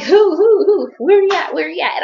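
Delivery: high-pitched, eager rapid questions; imitating mom. Retelling mom in the car asking where he is, high excited imitation. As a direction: repeat it high like an excited mother. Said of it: "She was like".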